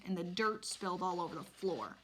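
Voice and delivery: ordinary delivery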